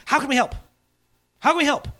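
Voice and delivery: high, eager repeated offer. Retold or imitated. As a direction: jump in keen to help. Eager offer to help ideas.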